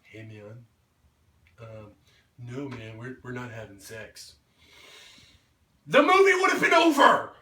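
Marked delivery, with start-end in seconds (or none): 0.0-4.4 quiet, much softer, timid refusal; a limp, apologetic no, imitated; meek half-hearted no. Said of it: "instead of being like".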